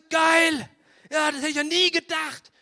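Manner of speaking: high, loud, delighted; young eagle flying first time, overjoyed; thrilled eaglet. Acted. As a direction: burst out with joy.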